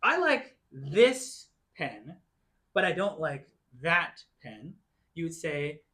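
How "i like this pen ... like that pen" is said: slow, with "this" and "that" set apart; slow example sentence. Shown as a demonstration. Teacher giving an English example of this and that, slowly. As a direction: lay out the contrast slowly. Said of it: "I can say".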